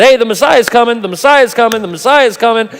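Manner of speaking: loud, high, eager herald calling out; excited announcing. Retold or imitated. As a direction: proclaim it like a town crier. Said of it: "John the Baptist went before Jesus and said".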